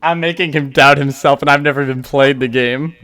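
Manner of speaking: loud, gleeful brag; loud gloating. Real, not acted. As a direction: crow about the prank. Gloating loudly at trolling.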